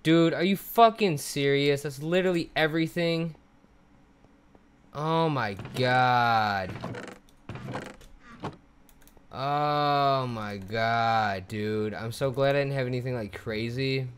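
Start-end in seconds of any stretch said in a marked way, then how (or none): 0.0-3.3 exasperated anger. Real, not acted. Angry at the loss. 4.9-11.9 stunned disbelief. Real, not acted. Stunned after the loss.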